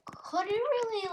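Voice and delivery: ordinary delivery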